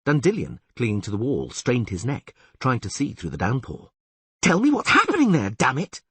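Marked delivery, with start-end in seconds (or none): 4.4-6.0 high, loud demanding cry; impatient shout. Acted. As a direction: bark it out, frantic. Dandelion demanding answer.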